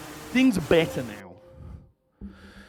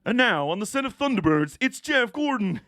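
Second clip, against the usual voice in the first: mock show announcer; 5 semitones higher than usual. Introducing Jeff Gordon on a Thunderbirds set, hammed-up announcer. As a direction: announce the star of a puppet show.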